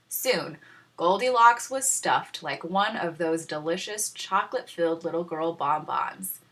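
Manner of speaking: plain reading voice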